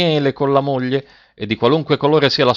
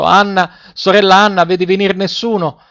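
high, louder narrated call to the tower; crying out; 7 semitones higher, 4 dB louder than usual. A narrator's reading. Wife to her sister atop the tower, crying out. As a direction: call up anxiously, pitched well above the narration. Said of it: "le gridava di tanto in tanto".

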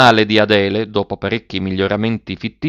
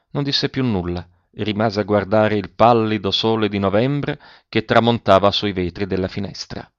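usual speaking voice

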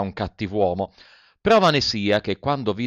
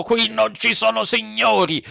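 harsh retort; 10 semitones higher than usual. A narrator's reading. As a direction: snap back hard. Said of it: "replicò duramente".

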